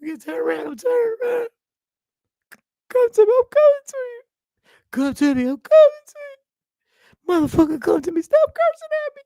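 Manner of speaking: high, tearful, rising to a wail; hammed-up sobbing terror; panicked crying plea. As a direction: beg the cops while bursting into tears. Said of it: "I'm like".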